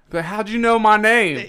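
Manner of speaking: loud, high, mock-bewildered question; astonished outburst. Gamer misreading a chat abbreviation as his name, astonished. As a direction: blurt it out in comic confusion. Acting out confused reply in gaming story.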